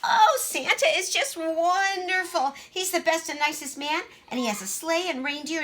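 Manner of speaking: high, loud and breathless about Santa; gushing child. Acted. Greta telling Missus Goose all about Santa, gushing. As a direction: bubble over with excitement.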